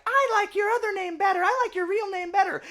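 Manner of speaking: put-on very high, fast girly squeak; put-on falsetto, rattled off quickly; Mayuri. Mayuri tells Okabe which name she likes, squeaky mock falsetto. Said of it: "whenever Mayuri's like".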